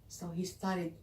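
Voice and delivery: plain reading voice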